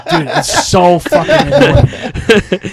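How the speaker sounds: laughing through the words, slow; cracking up mid-complaint. Real, not acted.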